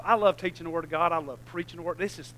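usual speaking voice

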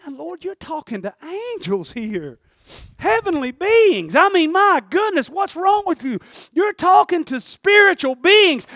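high, loud, drawn-out preaching rebuke; astonished scolding. Acted. Onlooker scolds Mary for ignoring angels, astonished shouted scolding. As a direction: scold at the top of the voice, stretching the words.

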